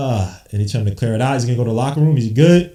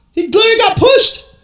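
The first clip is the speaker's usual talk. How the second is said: high, loud complaint to the referee; indignant fan yell; outraged protest; 8 dB louder than usual. Real, not acted. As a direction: shout it in disbelief at the missed call. Fan protesting a missed foul.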